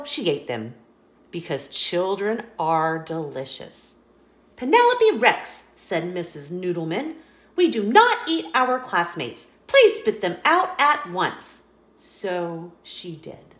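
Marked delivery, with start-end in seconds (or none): 4.6-5.7 high, loud scolding call of her name; teacher catching a pupil eating classmates, loud and high; stern teacher. Acted. 7.6-11.6 louder, firm classroom telling-off; teacher ordering the pupil to spit out classmates, loud and firm; stern teacher. Acted. Teacher scolds Penelope.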